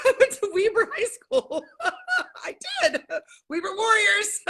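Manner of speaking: laughing while reminiscing, high. Real, not acted. Laughing to Julie about Weber High School. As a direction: giggle through the school memory.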